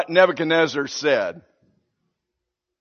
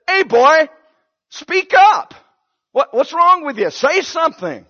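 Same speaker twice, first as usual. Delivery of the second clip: impatient king, louder and higher; aggravated king; 8 semitones higher, 5 dB louder than usual. Retold or imitated. King losing patience with speechless Daniel, barking. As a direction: demand he speak up, losing patience. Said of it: "the king's getting a little aggravated".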